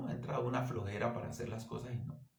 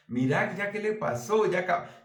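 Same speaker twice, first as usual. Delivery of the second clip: sister mocking; 8 semitones higher, 6 dB louder than usual. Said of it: "Decía mi hermana".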